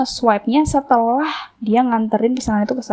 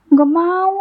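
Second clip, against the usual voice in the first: higher-pitched mock refusal; retold refusal; 7 semitones higher than usual. Customer turns down the driver's transfer offer, retold refusal. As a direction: refuse as if retelling a standoff.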